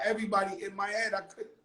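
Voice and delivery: usual speaking voice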